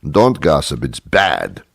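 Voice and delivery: usual speaking voice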